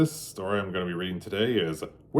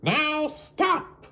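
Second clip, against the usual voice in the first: put-on high boyish order; Max commanding. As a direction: stop them like a bossy little king. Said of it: "Max said".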